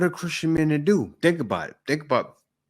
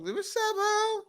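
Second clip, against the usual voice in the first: squeaky very high greeting, a bit softer; imitating girls' greeting; 5 dB quieter, slower than usual. Asking why girls greet each other in a very high squeak. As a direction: pitch it way up like a girlish hello. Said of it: "Why do they talk".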